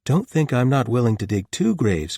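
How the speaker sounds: loud playful threat; mock threat. A narrator's reading. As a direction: menace him jokingly. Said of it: "mock swung the shield".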